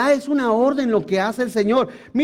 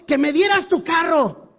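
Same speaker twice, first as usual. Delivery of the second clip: mocking charlatan; 4 semitones higher than usual. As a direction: ham up a greedy false prophet's demand. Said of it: "Y dice".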